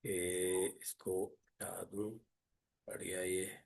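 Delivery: very soft, low, absent-minded self-talk; muttering to oneself. Real, not acted. Teacher to self while removing something from the sheet, muttering. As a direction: think aloud under your breath, slowly.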